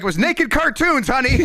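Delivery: high, mock dad calling out to his wife; hammed-up father's answer; imitated dad. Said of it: "my dad being like".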